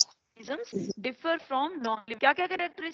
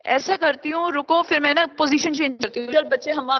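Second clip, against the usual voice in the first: raised voice; 3 semitones higher, 10 dB louder than usual. Real, not acted. Teacher raising voice, bad connection.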